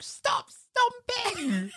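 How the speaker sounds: high, slow mock mum scolding; nagging mum. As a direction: drag it out like a cross mum. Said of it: "She's like".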